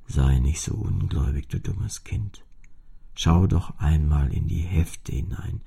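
put-on an old instrument lecturing the child; deep-voiced old cello telling its story. A narrator's reading. The cello explains its name.

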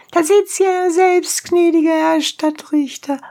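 very high character call; high-pitched appeal in peasant character; donkey driver calling. A narrator's reading. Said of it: "rief Anthrax".

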